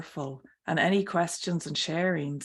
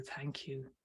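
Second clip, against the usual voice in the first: softer echoed thanks; 11 dB quieter than usual. Retold or imitated. Reading a viewer's thanks to the guest, much softer.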